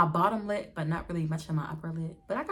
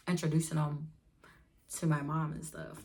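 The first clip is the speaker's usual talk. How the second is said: normal talking voice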